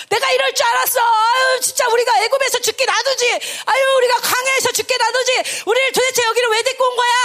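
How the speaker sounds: high, agitated wail of blame; resentful complaining. Acted. Israelites blaming their leader after the spies' report, resentful outcry. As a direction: rail at the leader in a high, loud-edged voice. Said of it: "막 원망하기 시작하는 거야".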